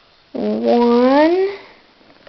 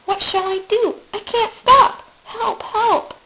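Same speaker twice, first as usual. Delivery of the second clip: higher, calling out for help; high panicked cry; 9 semitones higher than usual. Acted. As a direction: panic as you cannot stop. Runaway engine calls for help.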